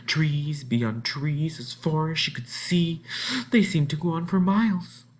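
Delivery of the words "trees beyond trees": plain reading voice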